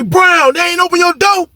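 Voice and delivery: high, carrying mock holler; imitated calling out. Inmate calls across the cells to Brown, mock holler. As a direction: call to a man behind a locked door.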